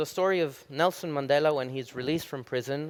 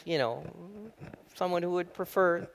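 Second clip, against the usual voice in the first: normal talking voice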